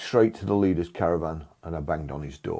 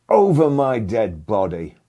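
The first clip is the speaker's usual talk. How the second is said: slow, loud, higher defiant yell; shouted; 5 semitones higher, 8 dB louder, slower than usual. Retold or imitated. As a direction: bellow it as the parting shot. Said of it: "I shouted".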